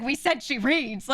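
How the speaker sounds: imitating an annoyed father; pissed-off dad. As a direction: insist on it slowly, word by word, fed up. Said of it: "getting kinda pissed".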